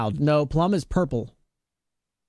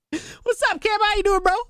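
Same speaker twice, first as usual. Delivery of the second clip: high, bright shout-out to a viewer; excited greeting. Real, not acted. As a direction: greet them with big energy.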